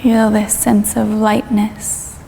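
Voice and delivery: calm yoga teacher leading a meditation; guided meditation. Acted. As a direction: ease the listener down through the body, unhurried. Leads a guided body-scan meditation.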